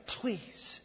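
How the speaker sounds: sudden whispered plea; tearful plea. Acted. Father of the child begging Jesus, whispered plea. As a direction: drop to a breath, begging. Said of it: "said with tears".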